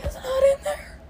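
high, tearful retold whine; squeaky near-tears retelling; mock crying. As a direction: act out the start of crying. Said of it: "I started to cry".

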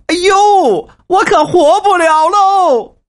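high, loud, drawn-out lament; hammed-up wail. Old man wailing to his daughter in a hammed-up lament. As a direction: wail that you can't go on.

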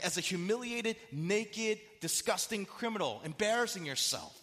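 high-pitched, scornful taunts; mocking. Onlookers shame the crucified Jesus, mocking. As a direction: heap scorn on him, item by item. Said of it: "in a mocking way".

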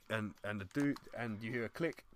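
ordinary delivery

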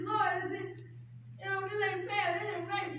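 pleading, high and strained, close to tears; tearful complaint to God. Retold or imitated. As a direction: retell the crying prayer, voice breaking. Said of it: "And I started crying".

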